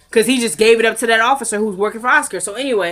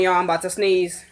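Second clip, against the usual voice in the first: ordinary delivery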